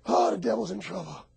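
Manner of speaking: ordinary delivery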